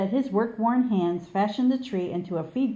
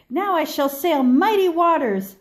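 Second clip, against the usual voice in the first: raised high and loud, proudly; proud call; 8 semitones higher, 7 dB louder than usual. Acted. The second tree proclaims its dream, loud and proud. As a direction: boom it out like a proud ship.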